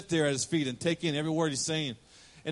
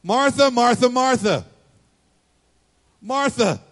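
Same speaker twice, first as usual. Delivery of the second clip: high, loud, drawn-out repeated name; Brady-style exasperation; 6 semitones higher, 8 dB louder, slower than usual. Retold or imitated. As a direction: call her name like a fed-up sibling. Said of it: "Jesus has a Brady moment".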